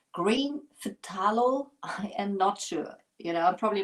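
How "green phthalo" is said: "Green phthalo" drawn out slowly, sounded out as a guess; unsure pronunciation. Shown as a demonstration. German crafter testing an unfamiliar paint name, slow and tentative. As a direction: feel your way through "phthalo" slowly and carefully. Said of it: "how do you pronounce that?".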